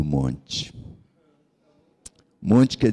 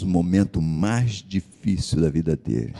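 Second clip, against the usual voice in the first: ordinary talk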